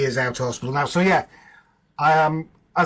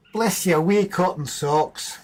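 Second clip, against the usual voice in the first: put-on slow, higher-pitched Scottish accent; affectionate blessing in a put-on Scottish accent; 5 semitones higher, slower than usual. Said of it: "if you want a harsh Scottish accent".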